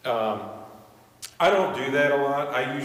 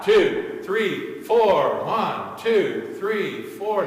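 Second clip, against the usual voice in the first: loud, higher counted beats; loud counting; 5 semitones higher, 6 dB louder, much slower than usual. Shown as a demonstration. Conductor switching the class to a loud beat, counting loudly. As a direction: call out the beats. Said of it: "Now loud".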